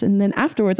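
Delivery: usual speaking voice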